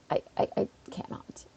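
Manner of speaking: soft stammered surrender; a hushed, halting refusal; whispered give-up. Real, not acted. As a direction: whisper it, defeated. Gives up on British accent.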